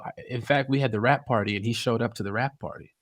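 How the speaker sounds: ordinary delivery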